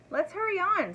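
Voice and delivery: high, urgent call to hurry; urgent dragon. A narrator's reading. Dragon urging Elmer to leave the rock, high and urgent. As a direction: push them to move on fast. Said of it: "said the dragon".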